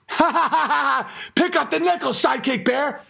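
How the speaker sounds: high cackling laugh then taunting order; mocking villain laugh. Villain on a tractor taunting the bear, high mocking cackle. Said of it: "Glenn Beck is just sitting on a tractor laughing his ass off".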